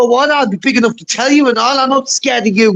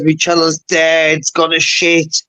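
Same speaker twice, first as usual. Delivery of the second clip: mocking quote; 8 semitones lower, slower than usual. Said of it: "she says".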